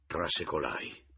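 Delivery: ordinary delivery, nothing marked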